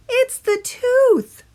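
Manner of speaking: high, very slow tooth creature; drawn-out squeaky voice of a talking tooth. A narrator's reading. The tooth speaks.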